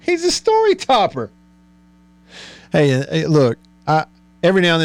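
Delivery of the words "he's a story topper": very high-pitched playful jab; teasing glee. Pretend. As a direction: squeal the tease in mock accusation. Gleefully echoes the teasing label.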